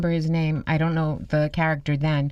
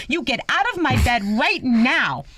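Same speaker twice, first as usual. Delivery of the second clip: high, slow, emphatic retold command; mock outrage, each word driven home; indignant order; 8 semitones higher, 3 dB louder than usual. Grandmother hitting intruder with pocketbook, indignant.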